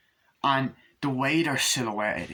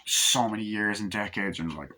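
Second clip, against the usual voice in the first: ordinary talk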